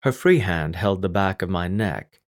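usual speaking voice

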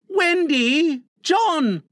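put-on high, urgent child's call; alarmed calling.

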